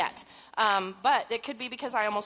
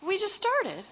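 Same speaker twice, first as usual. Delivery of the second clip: high-pitched plaintive protest; playful dismay; 10 semitones higher, slower than usual. Real, not acted. Speaker protesting the short time left, playful dismay. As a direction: whine it high, falling away at the end.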